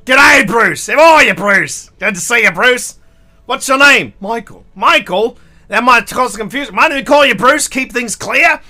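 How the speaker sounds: loud, hearty Australian mate voice; hammed-up Aussie bloke. Retelling Pip's Australian joke, a loud hearty Australian greeting. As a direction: boom it out like an Aussie bloke meeting a stranger. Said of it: "Pip was like".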